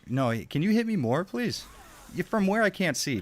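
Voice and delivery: please? jumps high in mock begging; sarcastic high-pitched please?. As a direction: beg with a sarcastic upward lilt on please?. Mock pleading to enemy.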